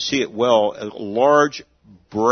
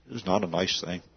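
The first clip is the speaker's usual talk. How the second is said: "It was not a nice thing" said much softer and lower, sombre; quiet solemn repeat; 5 semitones lower, 8 dB quieter, faster than usual. Real, not acted. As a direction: drop it low and quiet, let it sink in.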